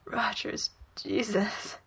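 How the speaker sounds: tight, strangled voice. A narrator's reading. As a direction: squeeze the words out.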